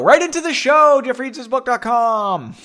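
loud, high promotional call-out; show plug. Pretend. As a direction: belt out a mock advert. Plugs the show to listeners.

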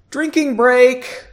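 high, slow sing-song; playful. Real, not acted. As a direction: announce it playfully. Joking aside during reading.

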